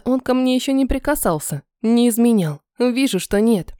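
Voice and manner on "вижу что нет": plain reading voice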